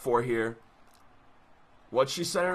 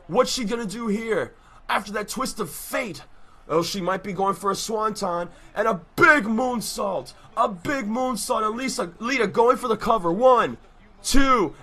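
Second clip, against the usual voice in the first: rising hype, louder toward the kickouts; wrestling commentator; 4 semitones higher, 3 dB louder than usual. Acted. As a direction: call the match like a ringside announcer. Live wrestling play-by-play.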